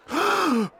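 high, sharp gasp of shock. A narrator's reading. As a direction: suck in air in horror. Father shocked at mouse son.

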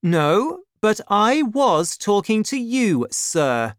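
high, loud retort; angry cry. A narrator's reading. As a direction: throw it back at him, affronted. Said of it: "cried D'Artagnan".

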